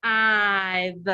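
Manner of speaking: "I've" as a separate f, then a long held "ive"; sounded-out long I. Shown as a demonstration. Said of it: "I heard the I sound in the word five".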